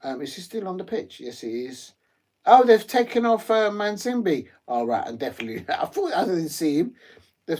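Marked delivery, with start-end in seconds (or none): 2.5-4.5 shocked at the substitution news; sudden surprised outburst. Real, not acted. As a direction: caught off guard, higher and louder.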